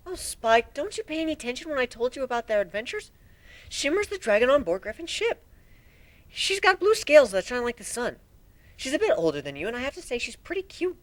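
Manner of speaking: a friendly unicorn teasing the little dragon; Twilight, teasing. Acted. Twilight voiced.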